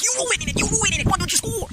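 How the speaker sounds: very high-pitched, loud, fast mock shriek at the players; frustrated yelling at the team. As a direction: squeal it like a fan losing his mind at the telly. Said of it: "And I was like".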